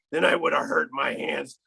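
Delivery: usual speaking voice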